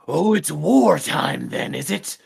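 drawn-out, put-on dwarf challenge. Dwarf turning to the archer after a near-miss arrow, gruffly challenging. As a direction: play a stubby dwarf squaring up for a fight. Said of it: "little short, stubby, dwarf-looking man".

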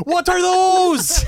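high, drawn-out mock shout; mock yell. Host yelling a movie catchphrase as a joke, mock yell. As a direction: stretch it into a loud, squealed catchphrase.

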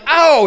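husband yelping as hair is pulled; pained ow. Retold or imitated. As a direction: cry out in sharp pain. Reacting to pulled nose hair.

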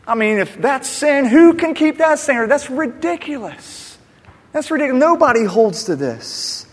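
high, loud, scoffing disbelief; scornful mockery. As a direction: sneer at the idea like it's absurd. Interviewers mocking Mark Richt.

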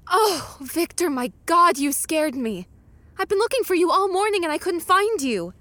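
loud, high, startled scolding; startled relief. Acted. Fiancée to man back home, startled. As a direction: react as if just given a scare.

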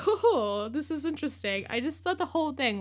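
put-on high-pitched silly British accent; mock British accent. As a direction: imitate a stagey British accent. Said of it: "keeping up in his stupid British accent".

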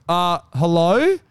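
slow, questioning hello in a normal pitch; unsure, drawn-out reply to a knock; hesitant answer. Retold or imitated. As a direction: answer uncertainly, drawing the word out. Retold wary answer at the door.

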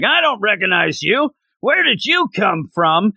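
a lightly voiced startled goon; surprised pirate. Pirate opens the door and spots Nami, mock startled.

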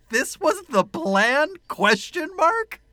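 high, slow disbelieving question; incredulous. Retold or imitated. Host reading her own baffled movie note, incredulous. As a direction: read the note in mock disbelief. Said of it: "my note was".

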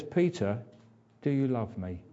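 soft, patient asking; gentle question. Retold or imitated. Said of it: "He says".

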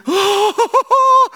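sharp astonished gasp; man seeing a hundred million in his account, gasping; loud high gasp. Acted. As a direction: gasp in shock at the balance. Seeing balance online.

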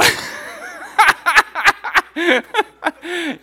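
high, loud burst of laughter; loud, hammed-up high laugh; imitated manic laughter. Husband retells his reaction on the ride, manic laughter.

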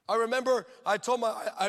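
usual speaking voice

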